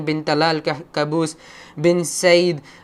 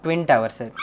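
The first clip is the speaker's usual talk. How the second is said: ordinary delivery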